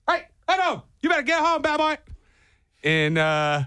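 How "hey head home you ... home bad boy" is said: very high, cutesy scolding voice; dog-scolding voice. Acted. Radio host on a bike telling off a loose dog, high-pitched scolding.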